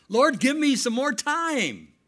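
high, loud, slow plea to God; a hint of Hezekiah crying out in prayer; pleading king. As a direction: beg the Lord for time.

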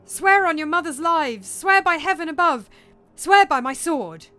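loud, high, fervent demands; commanding. Acted. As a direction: drive each oath home forcefully. Hamlet demands oaths.

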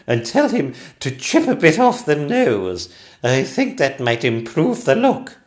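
high, loud regal instruction; a queen issuing orders grandly; ordering Queen. Acted. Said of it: "ordered the Queen".

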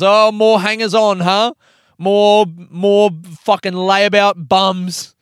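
loud, high, scornful outburst; imitated aggression. Housemate sneering at his friend about the guests, imitated aggression. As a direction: act out an angry housemate's jeer, slowing on the insult. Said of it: "being aggressive in this house".

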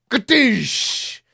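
high mock crash noise; imitated crash. Retelling the kids smashing the window, a high mock crash. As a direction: blurt out a cartoon smash sound.

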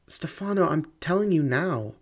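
high, desperate plea; desperate cry. A narrator's reading. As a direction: cry out to him, voice breaking. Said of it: "she cried".

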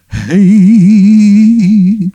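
loud, high greeting; a sudden loud "Hey" from the dark; the ghost pops up with a loud, high "Hey"; calling out. Acted.

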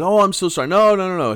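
imitating an apology. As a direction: act out someone apologizing profusely. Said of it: "And the guy goes".

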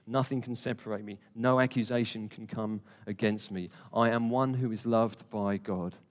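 normal talking voice

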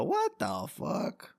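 very high, slow, drawn-out disbelief; stretched-out shocked what. Real, not acted. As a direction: react to horrifying news. Shocked by cartel massacre headline.

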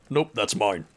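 low, possessive voice; deep voice. Acted. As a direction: say it low and claim it. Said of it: "a deep voice go".

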